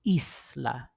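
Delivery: Isla as drawn-out "EEES... la"; stress on first syllable. Shown as a demonstration. Teacher showing the stronger vowel, Isla stressed "EES-la". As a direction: stretch the start of Isla, let "la" go lightly. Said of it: "is stronger than the L A".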